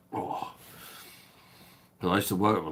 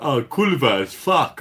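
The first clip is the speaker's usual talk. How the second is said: high, loud mock Polish accent; lightly put-on Polish accent; imitating Polish coworker; 7 semitones higher, 11 dB louder than usual. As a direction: voice the Polish guy explaining, higher and louder. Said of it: "And he's like".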